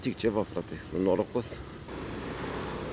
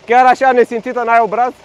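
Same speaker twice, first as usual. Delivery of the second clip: loud, high scolding; scolding the queue jumper, loud and indignant; indignant yell; 8 semitones higher, 7 dB louder than usual. Real, not acted. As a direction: shame her out loud. Scolding queue-jumping driver.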